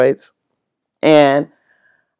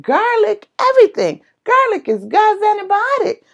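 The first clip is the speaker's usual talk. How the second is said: high, excited gush; getting carried away with excitement; can't contain the enthusiasm; hyper. Real, not acted. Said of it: "I feel myself getting hyper".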